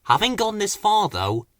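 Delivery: usual speaking voice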